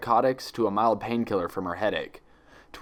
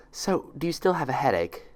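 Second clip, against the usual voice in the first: nurse voice; 6 semitones higher than usual. Acted.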